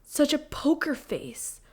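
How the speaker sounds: high, drawn-out teasing mom voice; amused mom. Retold or imitated. Daughter imitating mom who burst out laughing, amused. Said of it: "Mom burst out laughing".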